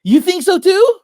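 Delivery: high, loud surprise; delighted surprise; surprised. Real, not acted.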